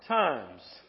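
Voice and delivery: usual speaking voice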